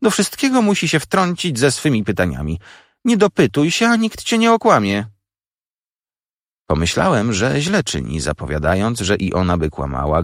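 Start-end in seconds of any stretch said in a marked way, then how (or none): 0.0-5.0 high-pitched, exasperated sister; scolding. A narrator's reading. Sister scolds the boy for meddling with questions, scolding. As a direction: scold the child, pitch climbing as you go.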